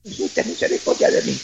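low, voiceless whisper; whispered plea. Acted. Lepers begging Jesus under their breath, whispered. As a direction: whisper it as if too faint to be heard. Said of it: "si ellos decían".

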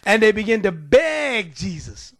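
ordinary talk

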